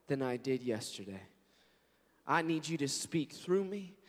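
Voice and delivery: ordinary talk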